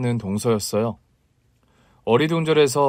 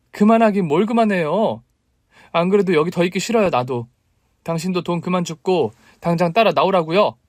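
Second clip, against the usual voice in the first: louder, high, snappy refusal; wife angrily refusing to stay; indignant retort; 6 semitones higher, 3 dB louder than usual. Acted. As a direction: fire it back at her husband.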